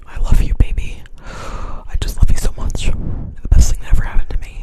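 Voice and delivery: whispered sweet nothings, teasing; mock loving whisper. Turning off the sound, whispering it mock-tender. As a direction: hush it like a lover teasing.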